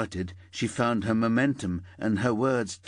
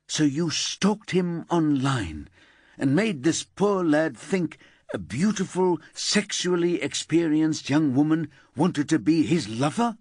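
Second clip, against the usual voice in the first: harsh detective; 7 semitones higher, 4 dB louder than usual. Acted. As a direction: press the suspect hard. Paula interrogates Elsa.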